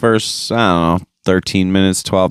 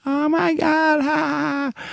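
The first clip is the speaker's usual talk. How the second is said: much higher, squealed fake-gushing laugh, softer; mock fangirl squeal; 6 dB quieter than usual. Mocking staged crowd members acting thrilled on camera, high fake squeal. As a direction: ham up a fake thrilled fan giggling for the camera.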